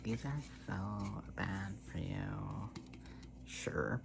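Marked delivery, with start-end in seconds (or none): 0.0-2.7 slow, drawn-out mocking old-person nag; mock old people. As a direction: stretch it out like a scolding elder. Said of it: "old people are like".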